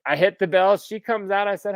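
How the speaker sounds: normal talking voice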